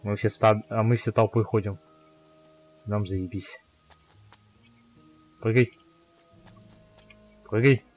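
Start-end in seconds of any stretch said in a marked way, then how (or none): none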